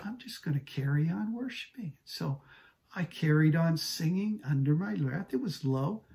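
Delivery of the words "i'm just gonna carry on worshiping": defiant whisper, a little higher; whispered inner resolve. Retold or imitated. Husband silently decides to keep singing, in a whisper. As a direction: mutter it stubbornly under your breath. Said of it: "I thought".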